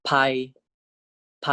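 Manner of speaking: teacher voicing ไพ for learners; Thai pronunciation demo. Shown as a demonstration. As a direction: model the word ไพ for the class to repeat. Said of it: "This is pronounced as".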